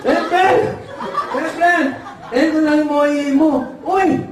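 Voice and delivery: high mock nasal speech; cheerful ngongo handing over the order; ngongo son. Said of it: "Pagpunta ng anak ng ngongo".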